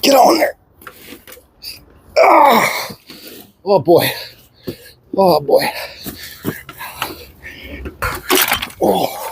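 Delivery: huffing and puffing with effort; winded, puffing between words; irritated huff. Real, not acted. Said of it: "Sorry about the huffing and puffing".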